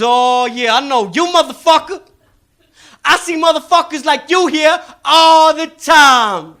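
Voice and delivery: loud high knowing drawl-free tease, slowing; knowing hustler. Retold or imitated. Comedian playing the salesman picking him out loudly. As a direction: lean in, you've got him. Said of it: "he goes".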